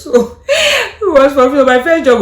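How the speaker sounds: laughing. Real, not acted. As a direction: giggle out the joke about working for free. Laughing about unpaid job.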